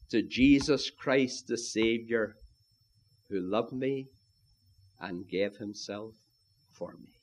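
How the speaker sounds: soft, low, with pauses between phrases; hushed reverence. Real, not acted. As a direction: let it fade reverently to almost nothing. Sermon climax hushed in reverence.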